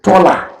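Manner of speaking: teacher highlighting taller; stress on taller. Shown as a demonstration. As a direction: point the class to the word taller. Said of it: "Look at it".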